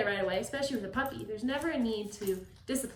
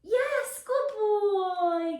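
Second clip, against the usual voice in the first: loud high praise; 11 semitones higher, 8 dB louder, much slower than usual. Real, not acted. Trainer rewarding puppy, loud high praise. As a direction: cheer the puppy on.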